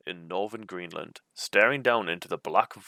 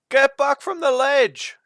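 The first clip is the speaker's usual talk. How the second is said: a much higher, louder, slow warning shout; urgent yell; 8 dB louder, slower than usual. A narrator's reading. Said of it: "you yell".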